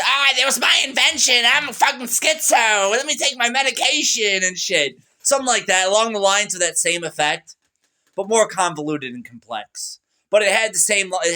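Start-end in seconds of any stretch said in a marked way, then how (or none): 0.0-5.0 high, put-on crazy voice for the FBI; acting crazy on the phone. Caller hamming it up to the FBI, mock crazy voice. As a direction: play the unhinged caller, pitched up. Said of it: "Like, I said".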